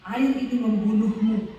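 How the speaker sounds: loud, mock-dramatic label reading; reading the warning loudly. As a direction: air ini membunuhmu read out loud, a mock-ominous warning. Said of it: "saya baca air ini".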